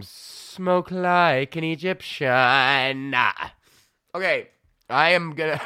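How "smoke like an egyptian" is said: very slow drawn-out slogan. Acted. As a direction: deliver it like a catchy slogan. Slogan ending the ad.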